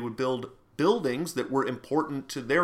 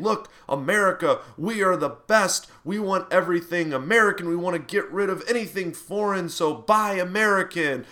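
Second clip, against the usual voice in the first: rally speech; 6 semitones higher, 5 dB louder than usual. Retold or imitated. Imitating a nationalist politician.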